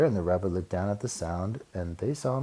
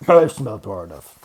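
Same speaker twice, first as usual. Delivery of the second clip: proud bear; 12 dB louder than usual. Acted.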